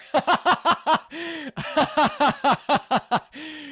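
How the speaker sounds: high, rising helpless laughter. Real, not acted. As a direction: lose it laughing, pitch climbing. Streamer laughs at comment.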